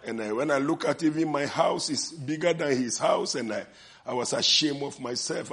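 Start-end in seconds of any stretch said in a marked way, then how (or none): none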